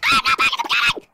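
very high, loud, fast shriek; piercing, rushed, terrified outburst; panicked scream. Real, not acted. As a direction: scream it in frantic denial. Panics in game loop.

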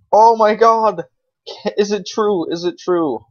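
high, loud gasp of disbelief; can't believe what just dropped; shocked disbelief. Real, not acted. Player sees a rare clan drop, shocked and amazed.

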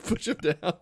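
cracking up while talking; laughing. Real, not acted. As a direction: squeeze the words out through giggles. Laughing through the joke.

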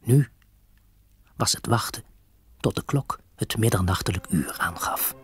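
usual speaking voice

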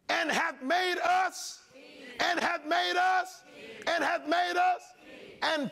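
hammering the line home from the pulpit; preaching shout. Real, not acted. Preacher repeating phrase at climax.

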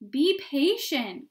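slow, loud fatherly call; crying out. A narrator's reading. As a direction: call out to the kids, drawn out and loud. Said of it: "cried the fathers".

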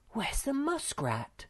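high, slowed horrified question. A narrator's reading. Moomin Mama asks after the missing muskrat, horrified. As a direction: ask it high-pitched, drawing it out in horror. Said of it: "asked in a horrified voice".

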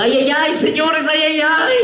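high, hammed-up clown cries; sing-song high mock wailing. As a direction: cry out like a clown in mock pain. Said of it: "el payaso gritaba".

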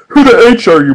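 high, loud panicked question; frantic. A narrator's reading. Mordecai panics at the kids from the future. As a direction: demand it in a panic. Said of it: "Mordecai asked frantically".

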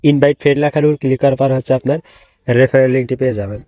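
ordinary talk, nothing marked

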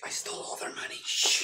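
sneaky whisper. Pretend. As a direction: whisper it so nobody hears. Confessing theft, then shushing.

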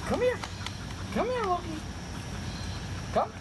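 very high squeaky dog voice; sing-song high call to a puppy; squeaky praising a dog. Real, not acted. Trainer coaxing dog to come.

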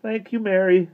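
high, mournful thanks; a touch of sadness, pitched high; sad. A narrator's reading. Mister Turvey thanking Mary for the cake, sad. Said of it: "said Mister Turvey sadly".

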